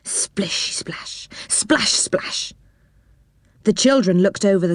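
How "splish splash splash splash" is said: splashing noise. A narrator's reading. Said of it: "a curious noise was heard".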